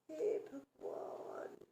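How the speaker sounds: high, slow whispered sigh of despair; exasperated whisper. Acted. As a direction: breathe it out in exasperation. Cornel exasperated.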